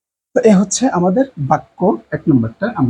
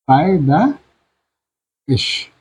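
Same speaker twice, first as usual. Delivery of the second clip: পায়দাইশ spoken very slowly for dictation; slow drawn-out Persian word; much slower than usual. Shown as a demonstration. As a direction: stretch পায়দাইশ out very slowly, syllable by syllable. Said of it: "এটা খাড়া জবর বা এভাবে দিতে পারেন".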